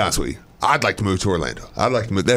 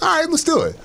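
jumps way up in pitch, eager; much higher, keen agreement; eager yes. Retold or imitated. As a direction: sell the instant yes. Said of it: "I'd probably be like".

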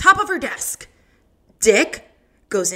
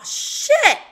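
high squeaky imitation of shit; mock comedian squealing the word. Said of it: "Did Michael just say".

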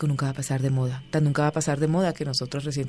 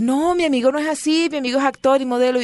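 high, loud and pleading; insisting friend; 8 semitones higher, 5 dB louder than usual. Retold or imitated. Voicing Hugo's insistent reply.

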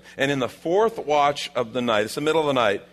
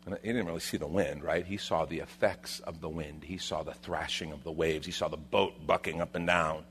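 low, soft storytelling; subdued, suspenseful narration; hushed sermon retelling; 7 semitones lower, 9 dB quieter than usual. A narrator's reading. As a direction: quietly build the sight of the storm. Hushed retelling of Peter's fear.

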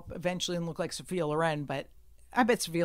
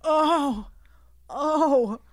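high, loud moaning complaint; milk a tiny injury; hurt old guy; 9 semitones higher, 4 dB louder, much slower than usual. Retold or imitated. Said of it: "he goes like this. He goes".

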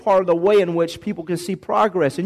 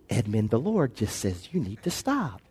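much lower and softer, timid and apologetic; a limp half-hearted rebuke, lower and much softer; meek mumble; 8 semitones lower, 9 dB quieter than usual. Retold or imitated. Timid believer gently tells Edmund to stop, meek and much softer. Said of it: "rebuke them sharply".

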